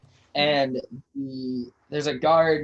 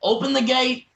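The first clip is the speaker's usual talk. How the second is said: loud, high shout up to the gate; guard yelling; 10 semitones higher, 6 dB louder than usual. Acted. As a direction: bellow the order upward. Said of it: "And he yells up".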